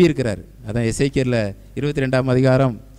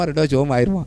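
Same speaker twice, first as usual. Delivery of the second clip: ordinary delivery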